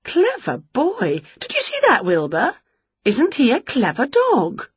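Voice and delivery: high, gushing praise for a dog; delighted Winnie. Acted.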